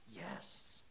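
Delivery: barely breathed, voiceless yes; whispered yes. Acted. Mark answering Jesus, whispered.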